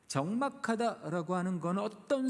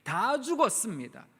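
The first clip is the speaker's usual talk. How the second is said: pleading lament; 5 semitones higher than usual. Acted. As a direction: pour out the complaint to God. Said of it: "하소연하고 싶었던 말을 쏟아냈던". (하나님께 절박하게 하소연하는 목소리)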